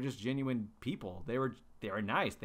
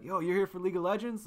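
raised, louder mock Aussie question; bad Australian accent; 7 semitones higher, 5 dB louder, faster than usual. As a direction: attempt an Australian accent and do it badly. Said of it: "that was the worst, you know, Australian accent ever".

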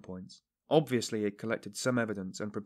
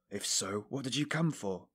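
irritable; 3 dB quieter than usual. A narrator's reading. Suspect asks investigator why he came, irritably. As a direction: challenge him with contained irritation. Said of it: "Raskolnikov asked irritably".

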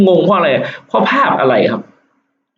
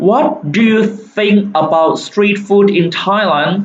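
very slow read; 5 semitones higher, much slower than usual. Shown as a demonstration. Reads question very slowly.

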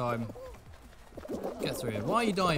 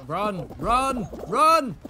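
building shout; 4 semitones higher, 7 dB louder, much slower than usual. Real, not acted. Gamer yelling at himself to run, rising panic. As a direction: run shouted louder and higher each time.

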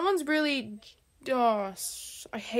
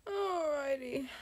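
ordinary talk

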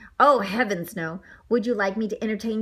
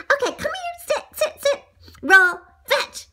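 high-pitched coaxing, louder on "roll"; pet commands; 4 dB louder than usual. Acted. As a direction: give short, high orders to a pet. Owner gives pet commands.

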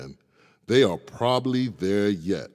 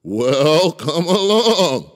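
much louder, higher challenge; big goat loud; 9 semitones higher, 9 dB louder, slower than usual. Acted. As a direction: dare the troll boldly. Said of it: "said the big billy goat Gruff".

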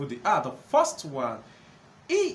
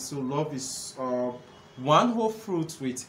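ordinary delivery

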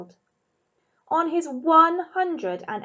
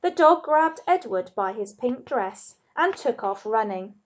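ordinary delivery, nothing marked

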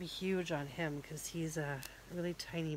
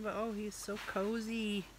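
usual speaking voice

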